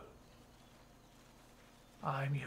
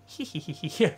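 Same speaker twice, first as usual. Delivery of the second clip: cartoon laugh; 3 semitones higher, 4 dB louder than usual. Real, not acted. Laugh after kill.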